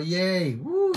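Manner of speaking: high woo cheer; celebratory yelp; happy cheer. Real, not acted. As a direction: cheer for the good news. Reacting to praise report.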